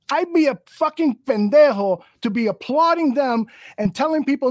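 normal talking voice

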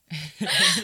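loud high laugh; real loud laughter; high burst of laughter. Real, not acted. Big laugh.

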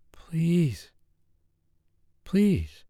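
high whispered begging; whispered pleading woman; whispered plea. A narrator's reading. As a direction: beg in a whisper, rising. Lover begging in bed.

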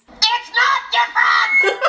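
high, drawn-out mock scream; hammed-up high-pitched screech; comical scream. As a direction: shriek it slowly, half laughing. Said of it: "[name] also leans in and screams".

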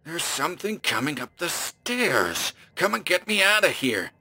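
panicked plea for rescue; gasping fear. Acted. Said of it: "breath was coming in gasps".

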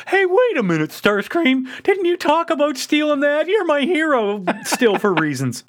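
put-on high, squeaky kid voice; mock kid voice. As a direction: ham up a whiny kid accusing him. [name] voiced mocking Starscream.

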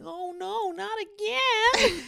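very high, slow wailing voice; panicked whine. Retold or imitated. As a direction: stretch out a shrill panicked wail. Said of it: "Scott, he's like".

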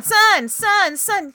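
put-on high, loud toddler chant; a little one fixated on one word; child repeating. Shown as a demonstration. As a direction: chant it over and over like an insistent toddler.

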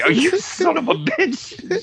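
high, slow, laughing exasperated groan; hammed-up exasperated manager; mock exasperation. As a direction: drag it out like a fed-up manager. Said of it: "she's just like".

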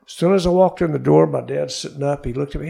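ordinary talk

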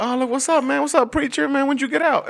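high, teasing call-outs; playful roast of audience members, high voice; crowd-work banter. Retold or imitated. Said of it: "I was like".

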